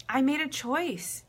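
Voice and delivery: ordinary talk, nothing marked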